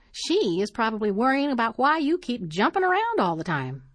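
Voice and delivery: a hint of a fussy elderly neighbour poking fun; prim old lady.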